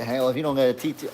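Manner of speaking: normal talking voice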